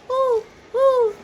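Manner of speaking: high hooting ooh ooh; mother hooting high like an owl for her toddler. Pretend.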